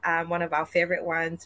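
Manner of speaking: ordinary delivery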